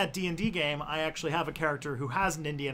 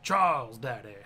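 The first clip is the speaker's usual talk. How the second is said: slow, low Cajun introduction; hammed-up Cajun drawl; Cajun accent; 4 semitones lower, slower than usual. Shown as a demonstration. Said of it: "work on a Cajun accent".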